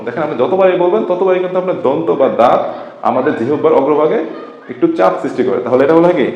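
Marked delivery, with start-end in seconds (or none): none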